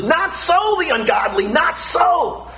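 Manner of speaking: high emphatic shout; ringing emphatic declaration. Acted. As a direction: proclaim it, cry out not so. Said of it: "It's emphatic".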